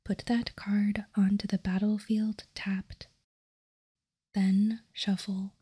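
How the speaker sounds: normal talking voice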